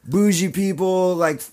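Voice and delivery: high, emphatic listing to Dad; exasperated insistence. Retold or imitated. Son convincing his preacher dad, retold exasperated insistence.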